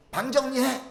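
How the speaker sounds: high, loud mock command to a child; parent's shouted order. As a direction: bark it like a nagging parent. (방 정리하라고 소리치는 부모 흉내)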